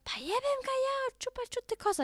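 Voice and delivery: mock-yell it like a furious mother; mom scolding. Imitating her mother yelling in Croatian about the messy room. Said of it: "Mama immer so".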